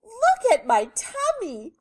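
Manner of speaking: high, loud, slow wail; drawn-out high-pitched dismay; distressed lady. A narrator's reading. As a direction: complain like a frantic storybook lady. Distressed lady shows her tummy.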